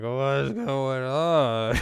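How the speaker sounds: imitated woozy drunk. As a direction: draw it out like someone wasted. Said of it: "He's drunk".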